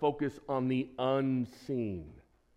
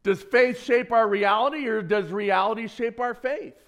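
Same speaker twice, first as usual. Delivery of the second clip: louder, higher game-show question; quiz show host; 7 semitones higher, 6 dB louder than usual. Shown as a demonstration. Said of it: "like an Alex Trebek kind of question".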